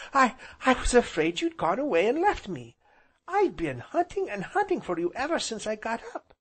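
put-on high-pitched child voice, stumbling start; panting small boy. A narrator's reading. Small boy afraid uncle had left, high panted voice. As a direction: blurt it high like a boy who ran. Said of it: "he panted as he came up".

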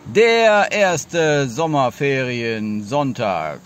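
slow deliberate. Shown as a demonstration. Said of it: "Dann machen wir jetzt mal rückwärts".

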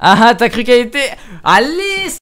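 high teasing singsong voice; playful high-pitched gloating; mock taunting. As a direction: tease the map in a squeaky mocking tone.

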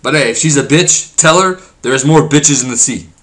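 ordinary talk, nothing marked

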